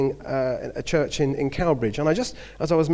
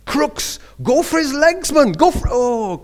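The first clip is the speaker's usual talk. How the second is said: high, loud coach yelling from the line; touchline shout; 13 semitones higher, 9 dB louder, slower than usual. Retold or imitated. Rugby teacher yelling at a boy on the wing, loud high shout. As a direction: bellow it across the pitch at a winger. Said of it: "I can remember him calling out from the".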